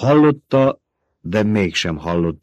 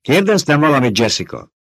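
sharp, louder demand; demanding snap; 7 semitones higher, 5 dB louder than usual. Acted. Old woman snapping at distracted Jessica. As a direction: snap at her to answer. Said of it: "csattant fel követelőzően".

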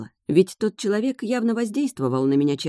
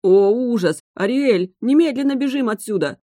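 panicked; 6 semitones higher, 5 dB louder than usual. A narrator's reading. Crab panics, wants to flee.

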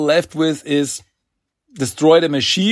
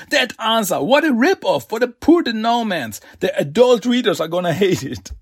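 higher-pitched hammed-up complaining; mock anger; 6 semitones higher than usual. Villain griping at the turtles about the ending, hammed-up higher anger. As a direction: grumble like a miffed critic. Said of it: "he gets really angry".

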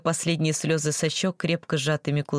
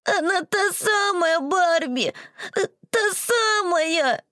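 put-on high, slow tearful child's wail; sobbing little girl; 13 semitones higher, much slower than usual. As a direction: sob it out like an upset girl. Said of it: "сквозь ... слёзные коты".

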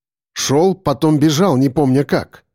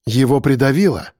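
plain reading voice

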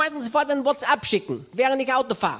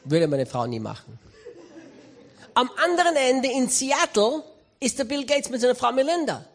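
usual speaking voice

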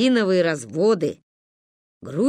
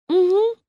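sleepy hum; 12 semitones higher, 5 dB louder than usual. Acted. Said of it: "промычали мы в полудрёме".

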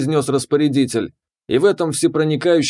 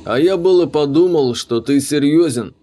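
plain reading voice

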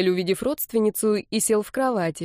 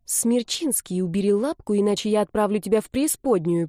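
ordinary delivery, nothing marked

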